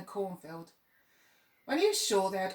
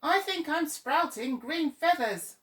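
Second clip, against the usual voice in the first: raised, louder weary crow sigh; a put-upon crow sighing about his feathers; hammed-up glum complaint; 8 semitones higher, 6 dB louder than usual. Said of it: "one crow sighed".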